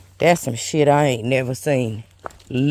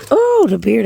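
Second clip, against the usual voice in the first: startled cry; 9 semitones higher, 7 dB louder than usual. Real, not acted. Knocks the beer over.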